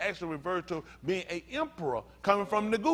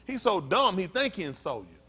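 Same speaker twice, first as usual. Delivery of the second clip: ordinary talk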